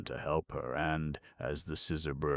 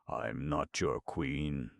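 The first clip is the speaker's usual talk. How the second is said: ordinary delivery, nothing marked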